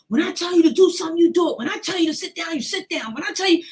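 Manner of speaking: high, loud, fast shouted orders; furious yell. Retold or imitated. Uncle pinning his niece to the floor, furious fast yelling. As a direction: scream the orders down at her.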